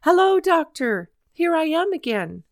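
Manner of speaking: high, loud eager hail; calling out. A narrator's reading. Boy runs down the road to the doctor, calling out. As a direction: call out a greeting from down the road. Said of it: "waving his cap and calling".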